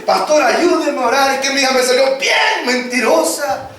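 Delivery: mother begging the pastor for help; pleading mother. Retold or imitated.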